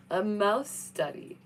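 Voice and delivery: slow, odd put-on voice on "A mouse study"; mock deadpan voice. Shown as a demonstration. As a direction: drag out "A mouse study" in a silly voice. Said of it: "repeated differently".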